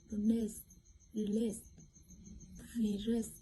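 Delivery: usual speaking voice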